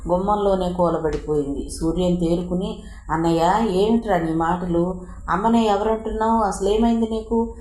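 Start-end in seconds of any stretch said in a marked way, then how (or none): none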